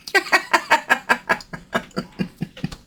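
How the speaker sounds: high, breathy wicked cackle; unvoiced witch's cackle falling away. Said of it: "my cackle".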